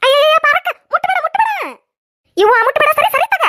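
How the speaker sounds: high, loud alarmed cries; woman frantically stopping her neighbour touching the chillies; panicked don't touch. Acted. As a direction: shriek at her to keep her hands off.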